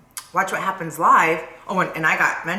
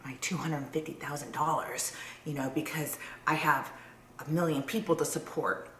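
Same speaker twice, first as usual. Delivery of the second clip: whispering; 6 semitones lower, 13 dB quieter than usual. Pretend. Voicing Noella's excuses.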